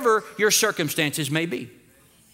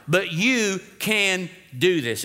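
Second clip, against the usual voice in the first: normal talking voice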